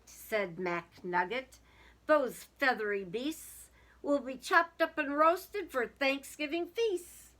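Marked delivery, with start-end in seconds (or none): 2.1-7.3 louder, a hammed-up ominous farmer; the farmer warning the turkeys' fate; farmer's announcement. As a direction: boom it out like a storybook villain. Farmer announces grim plan.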